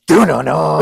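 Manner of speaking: loud "do no no" sting; imitated monster fanfare. Podcaster mimicking the Super Nintendo character popping up, loud mock fanfare. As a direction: belt out a video-game villain's entrance sound. Imitates the apparition's entrance sound.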